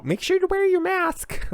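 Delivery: mock squeaky voice. Mocking mask advice voice.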